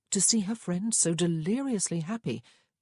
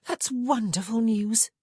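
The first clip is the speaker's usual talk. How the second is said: happily; 5 semitones higher, 4 dB louder than usual. A narrator's reading. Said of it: "Grace said happily".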